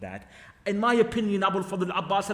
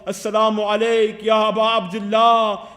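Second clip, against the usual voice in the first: calling out; 3 semitones higher, 7 dB louder than usual. A narrator's reading. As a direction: stretch the salutation out as a full-voiced call. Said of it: "he also calls out the call".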